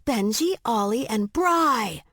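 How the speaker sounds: high, slow cheerful nicknames. A narrator's reading. Streep renaming the three glaring men, cheering. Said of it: "Streep cheered".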